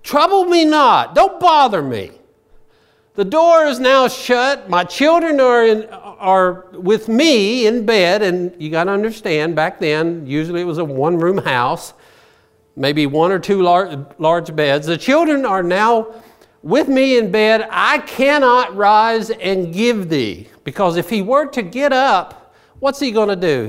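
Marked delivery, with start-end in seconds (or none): 0.0-8.2 loud, high, slow voice of the man in bed; man in bed refusing. Acted. 14.9-20.5 man in bed refusing. Acted. Voicing the man in bed again.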